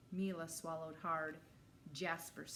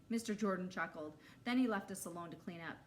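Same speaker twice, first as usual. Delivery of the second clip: plain reading voice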